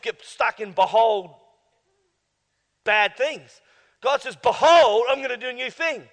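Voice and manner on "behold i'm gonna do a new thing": loud, high proclamation; proclaiming. Acted. Preacher voicing God's promise, loud and high. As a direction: announce it like God.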